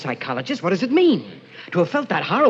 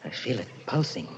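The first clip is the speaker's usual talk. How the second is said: eerie whisper; 7 semitones lower, 11 dB quieter than usual. Acted. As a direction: murmur it like a trance. Eerie hushed obsession.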